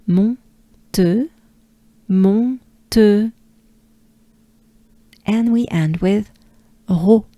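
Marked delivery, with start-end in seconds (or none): 0.0-3.3 "mon", pause, "te", nasal then rounded vowel; French syllables. Shown as a demonstration. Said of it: "Do it with me". 6.9-7.2 "ro" with breathed throat R, tongue down; breathy French R. Shown as a demonstration. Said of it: "just a breath".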